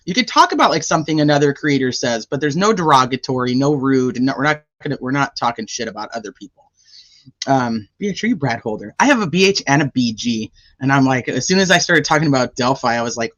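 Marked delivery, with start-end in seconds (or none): none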